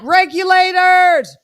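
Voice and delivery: loud, high, drawn-out mock call; rallying call. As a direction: belt it out like rounding up the posse.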